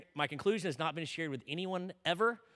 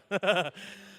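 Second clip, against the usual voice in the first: short laugh. Real, not acted. Laughter after the punchline.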